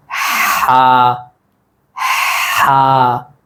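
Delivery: हा starting on a long breath, then a held vowel; two-sound letter. Shown as a demonstration. Teacher models the sixth letter, हा in two drawn-out sounds. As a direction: push out the h of हा, then sustain the aa. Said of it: "इस हा के अंदर दो आवाज़ें".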